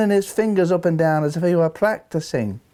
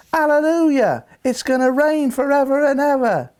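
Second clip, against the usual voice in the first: much higher, exclaimed mock chorus; retold choral shout; 9 semitones higher than usual. A concertgoer mimics the choir suddenly shouting, high-pitched mock cry. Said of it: "they suddenly shouted".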